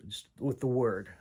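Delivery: ordinary talk, nothing marked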